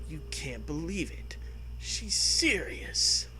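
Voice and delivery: normal talking voice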